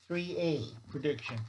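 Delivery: normal talking voice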